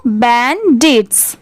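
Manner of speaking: बैंडिट्स slowly in two parts, बैन, डिट्स; slow, syllable-split बैंडिट्स; model for repeating. Shown as a demonstration. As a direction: draw out बैंडिट्स for pupils to echo. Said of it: "मेरे बाद repeat करिए".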